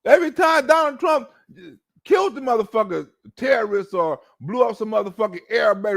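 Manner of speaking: normal talking voice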